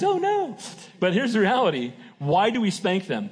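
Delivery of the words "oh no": much higher, hammed-up alarmed squeal; mock panic. Congregation panicking at the spanking question, mock alarmed squeal. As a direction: play the startled churchgoer caught out. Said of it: "Everybody panics".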